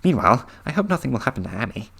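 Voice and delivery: ordinary delivery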